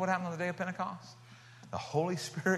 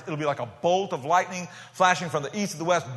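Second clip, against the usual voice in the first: normal talking voice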